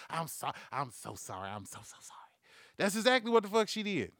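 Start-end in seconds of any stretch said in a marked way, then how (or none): 0.1-2.2 mock apology, low and fading away; hushed apology. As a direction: imitate a parent murmuring sorry, ever softer. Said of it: "but the whole time you".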